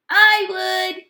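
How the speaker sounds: high, loud offer; eager snail. A narrator's reading. Snail offering help to the crab, high and loud. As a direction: pipe up eagerly. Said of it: "offered one of the snails".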